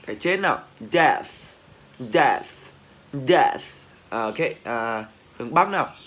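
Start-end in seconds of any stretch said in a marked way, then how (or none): 0.9-3.6 emphatic drill of "death" with full ending; loud released TH. Shown as a demonstration. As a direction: hit "death" hard and let the TH hiss out. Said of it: "bật càng to thì càng hay".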